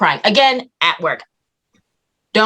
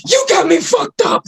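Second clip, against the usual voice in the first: high-pitched indignant outburst; retold yelling; 13 semitones higher than usual. Patient learning the sample is missing, high mock yell. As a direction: re-enact an outraged yell, pitch shot way up. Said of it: "So now I'm over here yelling".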